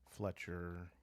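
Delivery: ordinary talk, nothing marked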